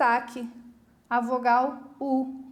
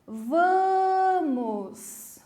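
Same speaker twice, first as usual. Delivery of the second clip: VAMOS higher, first syllable VA held long; calling out VAMOS; 5 semitones higher, 3 dB louder than usual. Shown as a demonstration. Teacher demonstrating to pupils across the river, long called-out VA in VAMOS. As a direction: call VAMOS far off, stretching VA and dropping on MOS. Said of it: "Como é que eu vou gritar a palavra vamos?".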